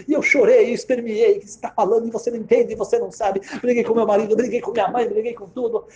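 high-pitched, worked-up listing; retold outburst. Daughter arguing with mother and husband, retold outburst. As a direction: reel it off high, reliving the fight. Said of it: "eu gritei com minha mãe".

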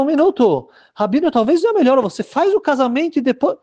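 high, hurried voice of those urging the rabbi; urging attendants. Acted. Attendants urging the rabbi to wait, high and insistent.

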